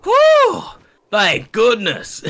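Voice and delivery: high, loud exaggerated relief; huge sigh of relief. Old lady hearing they are not Chinese, exaggerated relief. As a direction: mimic an old lady hugely relieved, drawn out. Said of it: "she breathes the biggest sigh of relief".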